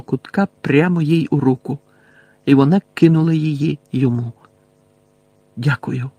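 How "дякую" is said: usual speaking voice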